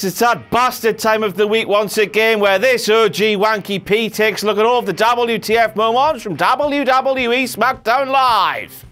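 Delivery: ordinary delivery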